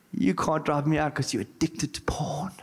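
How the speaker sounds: hushed sneering demon voice; evil demon whisper. Retold or imitated. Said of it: "But this evil voice".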